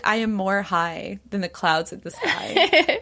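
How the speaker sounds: slow, stretched, with a laugh in it; woozy and giggly. Retold or imitated. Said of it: "like, woozy".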